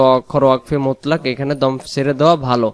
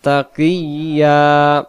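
slow, higher chanted recitation; 3 semitones higher, 4 dB louder, much slower than usual. Shown as a demonstration. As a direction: recite slowly with melody. Recites verse slowly.

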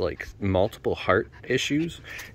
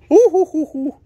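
excited whoop; 10 dB louder than usual. Real, not acted.

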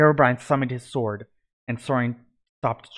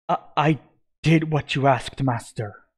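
stammering, raised and shaky with fear; 3 semitones higher, 3 dB louder than usual. A narrator's reading. Servant stammering to his dark master, afraid. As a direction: stammers out his plea, a little higher and louder. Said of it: "he stammered".

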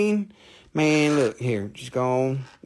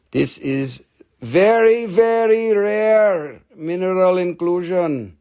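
slow emphasis; 4 semitones higher, 3 dB louder, slower than usual. Acted. Selling point stretched out.

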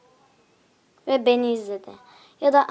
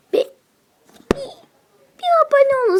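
higher, louder pleading cry; loud begging; 13 semitones higher, 10 dB louder, faster than usual. Acted. To viewers demanding subscriptions, loud high plea. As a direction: beg them loudly to subscribe.

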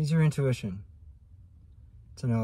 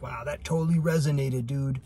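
higher, louder surfer-style gush; imitating a viewer; 4 semitones higher, 4 dB louder than usual. Viewer reacts to the reading, mock surfer gush. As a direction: play an excited fan saying dude. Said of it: "if you're like".

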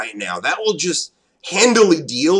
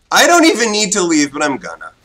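normal talking voice

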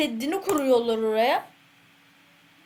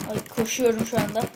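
usual speaking voice

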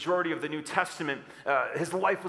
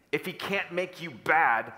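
normal talking voice

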